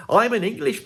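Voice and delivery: ordinary talk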